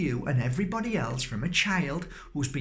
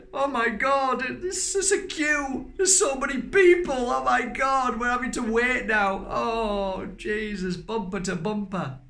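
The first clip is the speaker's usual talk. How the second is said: high, loud hammed-up whining in a queue; mock impatient driver; 10 semitones higher, 4 dB louder than usual. Speaker mimicking a driver moaning about the queue, hammed-up. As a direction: play a frantic driver stuck in traffic.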